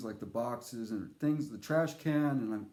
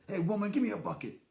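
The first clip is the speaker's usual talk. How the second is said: bossy cowboy voice; 5 semitones higher, faster than usual. Pretend. Demanding a bucket from the woman, hammed-up bossy. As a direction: bark it like a cartoon tough guy. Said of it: "it's fun to say it like that".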